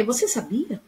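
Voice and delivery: Você sabia with a soft rise at the end; question intonation. Shown as a demonstration. Teacher showing pupils her own question, soft rising tone. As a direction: quote your own question lightly, rising. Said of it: "Eu fiz assim".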